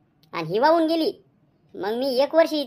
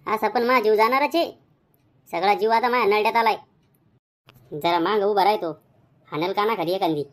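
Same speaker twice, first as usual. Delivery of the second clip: ordinary talk, nothing marked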